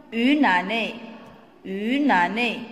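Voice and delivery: Une année with the n carried over, yn ane; liaison shown. Shown as a demonstration. Teacher shows pupils the liaison in Une année. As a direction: link une to année clearly. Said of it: "La liaison".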